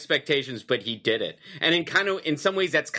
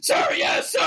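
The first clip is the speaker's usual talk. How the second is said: breathy sir yes sir; recruit reply. Acted. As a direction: snap back like a recruit. Recruit answering drill sergeant.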